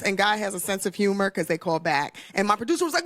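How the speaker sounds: ordinary talk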